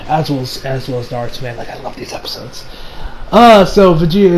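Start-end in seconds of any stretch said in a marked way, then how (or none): none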